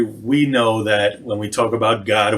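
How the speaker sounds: ordinary talk, nothing marked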